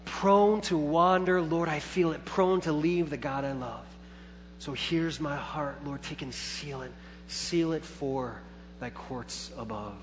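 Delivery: recited hymn. Acted. Pastor quoting the hymn to the church, softly prayerful. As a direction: offer the hymn lines tenderly. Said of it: "we sing with the hymnist".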